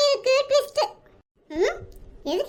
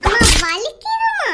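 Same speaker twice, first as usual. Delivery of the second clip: cry of pain; 4 dB louder, slower than usual. Acted. Son being hit by mother.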